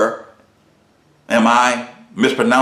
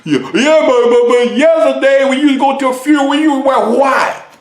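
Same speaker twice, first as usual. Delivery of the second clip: much higher, louder protest; mock objector; 6 dB louder than usual. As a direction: stammer out the objection. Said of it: "pretend objector interrupts".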